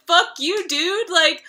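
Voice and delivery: put-on imagined rant at the boy, loud and indignant; pissed off. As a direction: tell him off like you're insulted. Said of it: "I'd be so pissed off".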